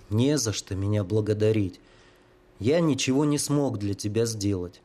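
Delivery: ordinary delivery, nothing marked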